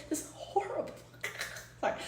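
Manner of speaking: stifled real giggle; laughing under breath. Real, not acted. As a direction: laugh it off quietly. Laughing at her own gaffe.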